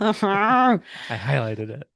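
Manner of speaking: loud, drawn-out words through laughter; high, laughing outburst. Real, not acted. Laughing over shared highlighting remark.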